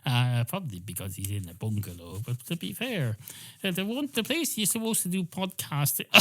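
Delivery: ordinary talk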